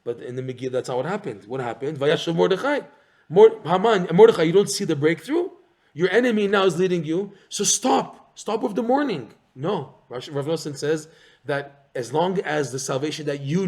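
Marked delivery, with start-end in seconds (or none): 2.0-9.3 raised, louder voice urging Mordechai to stop; a taunting Haman, higher and louder; mock urging Haman. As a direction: call out as Haman, pushing him to quit mourning.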